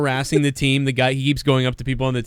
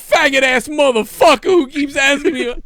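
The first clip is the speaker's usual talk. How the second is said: loud, high mock complaint; hammed-up outraged player; 13 semitones higher, 6 dB louder than usual. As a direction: yell an exaggerated complaint to the coach. Acted player complaining to coach.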